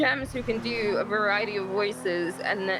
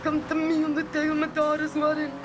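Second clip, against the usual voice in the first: Godfather impression; 6 semitones higher than usual. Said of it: "you're not the only one that can't do voices".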